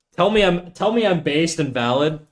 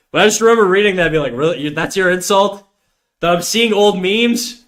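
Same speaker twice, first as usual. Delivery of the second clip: higher, louder incredulous outburst; triggered; 6 semitones higher, 5 dB louder than usual. Real, not acted. Streamer recalling his ex's meme insult, triggered disbelief. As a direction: scoff at the insult in a raised voice. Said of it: "You sound pretty triggered?".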